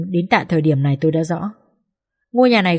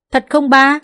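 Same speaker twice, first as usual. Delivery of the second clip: put-on hopeful child; 7 semitones higher, 5 dB louder, slower than usual. Child asks father hopefully.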